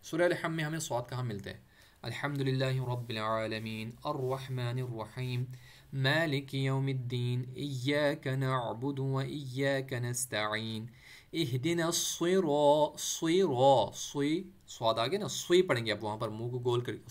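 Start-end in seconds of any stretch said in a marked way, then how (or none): 2.0-13.9 slow, measured tajweed recitation; तजवीद के साथ धीमी तिलावत; Quran recitation. Shown as a demonstration. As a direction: recite it slowly, like the prayer. Recites Surah al-Fatiha.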